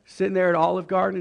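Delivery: ordinary delivery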